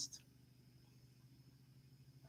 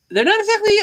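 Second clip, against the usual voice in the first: exasperated sarcasm; 12 semitones higher, 8 dB louder, faster than usual. Real, not acted. Loud high exasperated jab.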